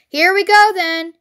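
high, loud call to start; shout. A narrator's reading. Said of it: "shouted Bean".

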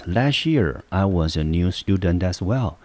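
ordinary delivery

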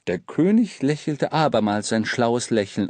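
usual speaking voice

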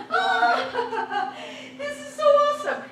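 high, laughing exclamation; giddy with joy; laughing delight. Retold or imitated. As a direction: laugh through the words. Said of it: "and I'm like".

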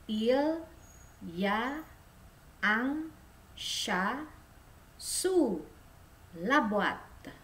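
"Il y a un chat sous la boîte" spaced out; teacher dictation; word by word model. Shown as a demonstration. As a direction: say each word alone. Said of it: "Silakan diulangi".

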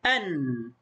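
أن as "an" with a held n, pitch falling; drawn-out أن sinking onto the nun; fatha tanween. Shown as a demonstration. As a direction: close أن on a humming n. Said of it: "إن كان عليها فتحتان فنقول".

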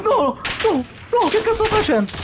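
high, fast panicked outburst; frantic exasperation. Real, not acted. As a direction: rattle it off in panic. Self-reproach in game.

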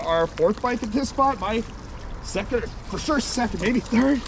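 normal talking voice

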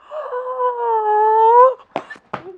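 long, high drawn-in gasp; awed gasp. Real, not acted. Viewer stunned by beautiful anime animation, awed gasp. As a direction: gasp high and loud, letting it swell.

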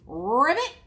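loud rolled frog croak, rising; little pig's ribbit. A narrator's reading. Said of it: "the little pig answered".

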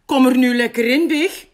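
high, loud, quick call. A narrator's reading. Animal friend urges Big into the water, loud calling out.

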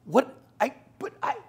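high, stammering confusion; caught-off-guard stammer; flustered protest. Retold or imitated. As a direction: sputter in disbelief. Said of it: "And I'm like".

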